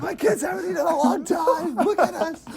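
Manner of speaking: high, singsong pleading customer; whiny customer voice. Retold or imitated. Customer begging for burgers, high and singsong. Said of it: "Hurry up, William".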